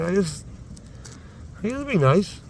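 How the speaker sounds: ordinary delivery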